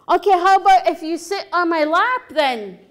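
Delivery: loud, high exasperated offer; frustrated. Acted. Chief bargaining with stubborn Frog Woman, loudly frustrated. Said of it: "he's really frustrated".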